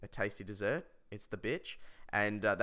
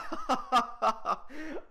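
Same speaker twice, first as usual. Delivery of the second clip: high forced laugh; laughing at own joke; 12 semitones higher than usual. Acted. Class joker laughing when nobody else does, forced laughter. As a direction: laugh on alone, hammed up. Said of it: "but he laughs at 'em anyway".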